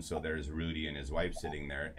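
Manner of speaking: ordinary talk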